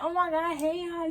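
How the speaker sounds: high, excited hello to friends; spotting friends across the street, hyped; hyped greeting. Retold or imitated. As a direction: call out, thrilled to see them. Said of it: "I'm hyped".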